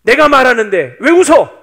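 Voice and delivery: loud, angry cross-examination building to a shout; scolding at full voice; God's fearsome confrontation. Acted. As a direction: call out the lie, furious. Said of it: "무섭게 따지시는 거예요".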